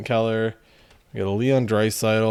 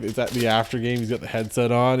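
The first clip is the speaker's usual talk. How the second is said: ordinary talk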